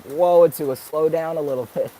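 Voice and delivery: high, loud mock protest; mock alarm. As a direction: playfully tell a fast talker to ease off. Mock reaction to own fast talking.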